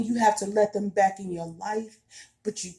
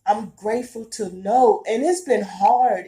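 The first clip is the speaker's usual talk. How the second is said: fighting back tears, voice swelling louder; real emotion, trying not to cry; holding back tears; 6 dB louder than usual. Real, not acted. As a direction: close to tears, pushing through. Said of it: "I'm trying not to cry now".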